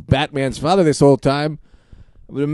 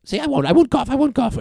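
much higher put-on woman's voice; 11 semitones higher than usual. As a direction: falsetto lady character. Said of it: "I end up talking like this".

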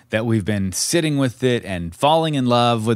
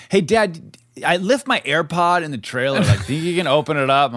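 put-on higher, boyish voice for the son; imitating his son; 6 semitones higher than usual. Dad retelling his son asking about the AirPod, boyish and higher. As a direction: play the kid asking casually for a favour. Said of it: "He goes, he's like".

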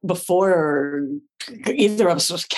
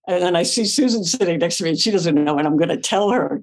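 usual speaking voice

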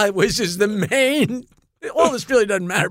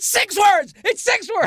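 high-pitched gleeful cry; excited shout; 12 semitones higher than usual. Real, not acted. Proud actor shouting to his friends about his line, a high excited yell. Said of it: "exclaims his line count".

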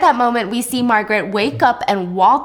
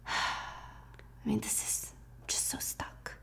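sighing whisper; 18 dB quieter than usual. Acted. Frustrated sibling sighs alone.

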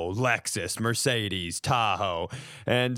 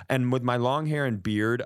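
ordinary talk, nothing marked

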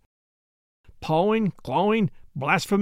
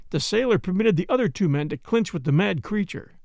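ordinary delivery, nothing marked

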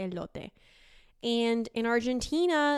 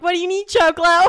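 much louder, higher, playful mocking call; teasing cooks; 8 semitones higher, 14 dB louder than usual. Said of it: "they're like".

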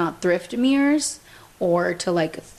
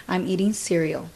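ordinary talk

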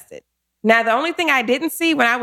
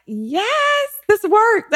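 high-pitched triumphant yes; so excited; 12 semitones higher, much slower than usual. Retold or imitated. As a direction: celebrate it high, reliving the excitement. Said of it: "so excited, like".

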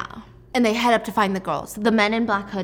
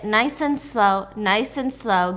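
slow coaxing; much slower than usual. Retold or imitated. Gunman coaxes girl out.

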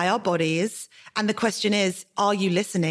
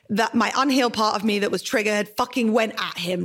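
usual speaking voice

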